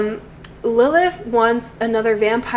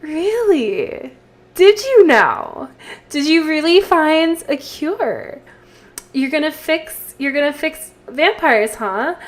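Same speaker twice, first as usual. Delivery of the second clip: higher, mocking fake-sweet disbelief; fake sweet sarcasm; 5 semitones higher, 5 dB louder than usual. Lilith mocks Caleb's vampire cure with a fake smile. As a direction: sneer it behind a big fake smile. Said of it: "put on a big fake smile".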